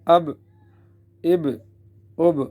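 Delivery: short echoing release on the closing b; qalqalah on ba. Shown as a demonstration. Teacher shows students qalqalah letters, bouncing the b. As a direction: bounce the b at each stop. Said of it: "قلقلہ کیا جائے گا".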